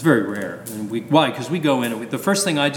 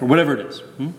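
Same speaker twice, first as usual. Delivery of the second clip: normal talking voice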